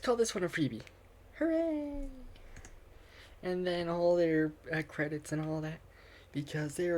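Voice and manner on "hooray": high, delighted hooray; excited cheer. Acted. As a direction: cheer like a happy kid. Character celebrates wish.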